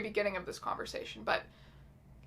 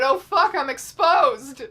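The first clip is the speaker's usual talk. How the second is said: mock alarm; 2 semitones higher, 9 dB louder, slower than usual. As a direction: play up the panic at being found out. Acting out being found out.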